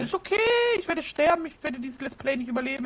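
high-pitched mock despair; gespielte Verzweiflung. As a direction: wail it as if doomed, playfully. Mock resignation to dying in game. (gespielt verzweifelt, hohe Stimme)

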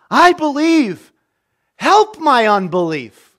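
high, slow, loud plea of a desperate father; pleading cry. Retold or imitated. A father cries out to Jesus for his son, in a high pleading cry. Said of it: "the father of the child cried out and said".

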